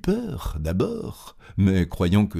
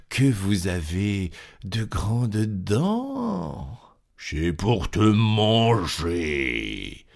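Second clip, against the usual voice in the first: wolf voice; slower than usual. Acted. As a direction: draw it out, sinister. Wolf answers, then strikes.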